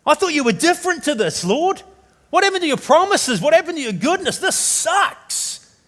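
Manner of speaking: high, loud, exasperated lament; crying out, let down and indignant; anguished protest to God. Acted. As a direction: hurl bewildered questions at heaven. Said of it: "the writer's going through and going".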